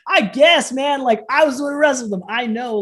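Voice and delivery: high, loud exasperation; indignant burst at being doubted; incredulous protest. Real, not acted. Protesting at people disbelieving his claim.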